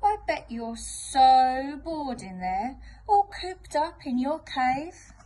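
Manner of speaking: drawn-out mock-sympathy from the wolf; wolf teasing. Wolf needles the creature about being cooped up, slow and teasing.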